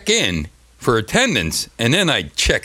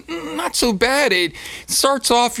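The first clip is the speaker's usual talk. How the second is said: ordinary delivery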